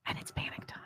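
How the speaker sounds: barely audible breathy whisper; faint whisper. Acted. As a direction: let it fade almost to nothing. Repeated even softer.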